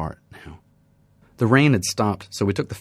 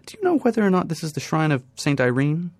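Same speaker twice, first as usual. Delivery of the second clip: put-on lightly raised, polite young woman's voice; a hint of a shy student asking the way; 9 semitones higher, 4 dB louder than usual. Young woman asks a stranger in the park, lightly raised and polite.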